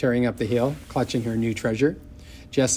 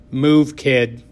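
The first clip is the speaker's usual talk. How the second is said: loud, blunt command; gruff bully order; 3 semitones higher, 8 dB louder than usual. A narrator's reading. Bully blocking the bus aisle, loud order. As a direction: bark it at the little kid. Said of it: "she said".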